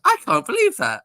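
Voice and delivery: high, amazed posh English voice; posh English visitor can't believe it; posh English friend. Retold or imitated. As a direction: squeal it in posh English astonishment. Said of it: "And he goes".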